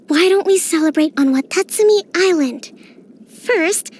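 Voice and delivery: ordinary talk, nothing marked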